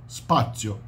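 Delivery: spazio with no vowel before the s; Italian word. Shown as a demonstration. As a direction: spazio the Italian way, starting straight on sp. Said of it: "Eles falam ainda spazio".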